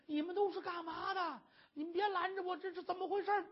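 high-pitched frantic protest; distressed crying out. A narrator's reading. Captured wife protesting to those holding her, distressed. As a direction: wail the questions high, without real volume. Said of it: "这儿喊着".

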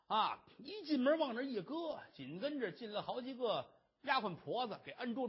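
ordinary delivery, nothing marked

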